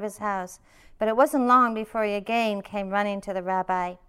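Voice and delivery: reading a folk tale aloud to the room; story reading. A narrator's reading. Teacher reads a rabbi story to retreat listeners, storytelling voice.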